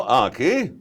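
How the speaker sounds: high, loud, slow, fussy dad; high, loud, slow dad reminding kids of chores; Doctor Light. Acted. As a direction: scold the kids like a flustered father. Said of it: "he's more of my own voice".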